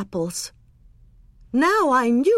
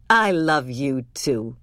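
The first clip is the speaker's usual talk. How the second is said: low, slow loving mother; tender; 8 semitones lower than usual. A narrator's reading. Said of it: "she said".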